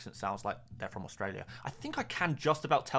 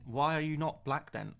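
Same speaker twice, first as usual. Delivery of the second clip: normal talking voice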